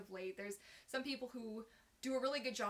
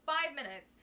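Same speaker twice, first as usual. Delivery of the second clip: louder, Five punched high; emphatic repeat; 4 semitones higher, 8 dB louder than usual. Real, not acted. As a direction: stress Five as if amazed.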